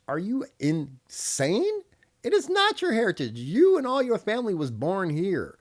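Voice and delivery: high, loud incredulous scolding; indignant outburst. Real, not acted. Retorting to people claiming heritage, loud and indignant. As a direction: scold them in disbelief, voice shooting up.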